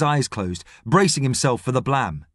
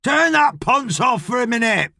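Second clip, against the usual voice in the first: high-pitched irritated shout; 10 semitones higher than usual. A narrator's reading. Boss yelling across the unit about the radio, shouting. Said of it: "Hooters rubs his nose and shouts".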